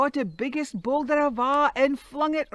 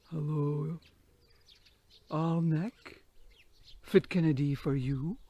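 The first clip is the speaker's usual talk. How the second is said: giant voice; 8 semitones lower than usual. Acted. Giant answers the devil.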